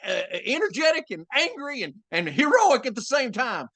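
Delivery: high, loud, energetic Texan hero voice; Robert Strait. Acted. Demonstrating a brother's voice for a rooster character, energetic and angry Texan. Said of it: "I just gave him that voice".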